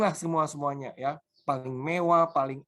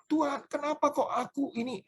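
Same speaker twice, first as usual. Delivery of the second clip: pitched-up mock complaint to God; imitating her rebellious cry, higher; wife's angry protest; 10 semitones higher than usual. Pastor retells his wife rebelling against God, higher protesting voice. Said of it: "dia marah".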